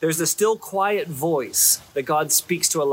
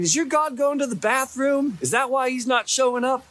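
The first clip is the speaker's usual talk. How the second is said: mocking taunt; 7 semitones higher than usual. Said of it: "mocks their God and, you know, makes fun of him saying".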